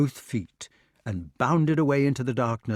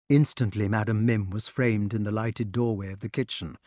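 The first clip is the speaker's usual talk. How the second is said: plain reading voice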